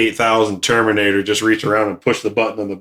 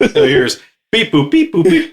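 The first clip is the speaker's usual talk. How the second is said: robot beeps; 6 semitones higher, 3 dB louder than usual. Retold or imitated.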